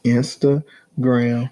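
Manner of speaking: mocking, very drawn-out INSTAGRAM; talking like they're deaf. Man teasing partner's slow speech, exaggeratedly drawn-out. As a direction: stretch out Instagram syllable by syllable, imitating her. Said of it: "You talking like they deaf".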